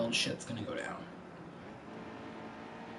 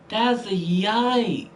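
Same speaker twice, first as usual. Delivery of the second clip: slow, higher, drawn-out "yikes"; emphatic yikes; 4 semitones higher, 4 dB louder, much slower than usual. Real, not acted. Racer with seven laps remaining, emphatic drawn-out dismay. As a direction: stretch it out and push it higher. Reacts to race, bigger repeat.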